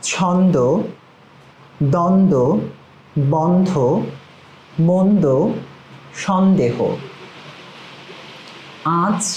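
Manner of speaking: each word punched out on its own, with clear nasal sounds; emphatic drill words. Shown as a demonstration. Pronunciation teacher leading the class word by word, emphatic and precise. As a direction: say every word firmly and pause for the echo. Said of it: "আমার সাথে সাথে আপনারা কিন্তু সেটি পড়বেন".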